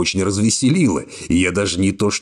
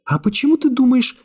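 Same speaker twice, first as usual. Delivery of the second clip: put-on lightly raised young woman voice, cool and calm; young woman's voice. A narrator's reading. Secretary coolly refusing the hero's embrace, high young woman voice. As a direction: voice her higher, calmly distant. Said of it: "спросила она удивлённо".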